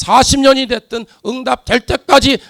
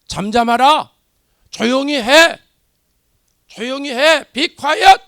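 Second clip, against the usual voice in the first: louder, higher scolding shout; mock crowd rebuke; 3 semitones higher, 6 dB louder, slower than usual. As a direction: hush him like an irritated crowd. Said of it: "꾸짖습니다".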